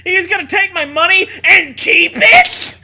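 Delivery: high, loud laughing indignation; laughing, shouted mock indignation; laughing mock outrage. Farmer's outrage continued, laughing.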